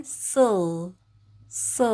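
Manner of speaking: "슬" with L as closing sound; "슬" L ile kapanan hece. Shown as a demonstration. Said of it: "L 받침으로 들어가면".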